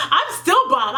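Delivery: high laugh into a proud boast; giggle, then own it. Real, not acted. Bragging to viewers that she's still bomb, laughing.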